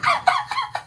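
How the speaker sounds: squeaky burst of laughter; high giggle; high-pitched laugh. Real, not acted. Laughing at animation.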